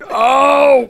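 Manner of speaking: high, shouted wordless "Oh!"; loud imitated yell. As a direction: blurt one loud high noise, mimicking someone unintelligible. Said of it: "nothing comes out of your mouth, but".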